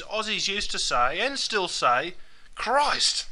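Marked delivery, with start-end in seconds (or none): 2.6-3.3 high, falling exclamation; pitched-up startled oath; surprised or angry exclamation. Acted. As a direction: exclaim it as if taken aback. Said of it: "when they're surprised or angry".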